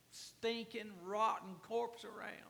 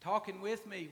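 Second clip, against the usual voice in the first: usual speaking voice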